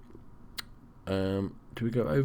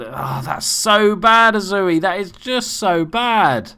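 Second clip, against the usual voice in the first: exasperated outburst; 7 semitones higher, 5 dB louder than usual. Real, not acted. His player wastes a pass.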